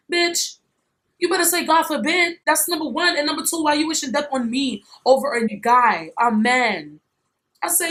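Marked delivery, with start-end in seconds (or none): 0.1-4.5 high-pitched, fired-up comeback; retold indignation. Telling off the friend who wished death, high and heated. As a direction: snap back at her, pitch raised.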